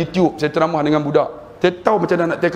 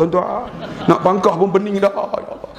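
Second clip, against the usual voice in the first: laughing; 7 semitones higher than usual. Real, not acted. Joking about confusing ballot.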